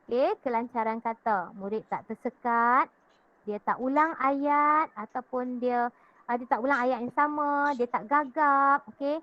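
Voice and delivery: usual speaking voice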